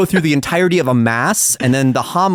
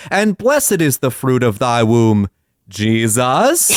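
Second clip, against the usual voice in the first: slow rosary recital, "blessed" punched and "Jesus" drawn out; wrong emphasis; 3 semitones lower, slower than usual. Retold or imitated. Grandson imitating the rosary woman at the funeral, wrong emphasis. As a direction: lean oddly on "blessed", then let "Jesus" hang and lift. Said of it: "putting the wrong emphasis on the word".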